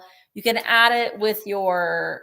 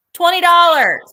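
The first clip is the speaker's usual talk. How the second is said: loud announcement; 8 semitones higher, 12 dB louder than usual. Acted. Whispered lead-in, then loud reveal.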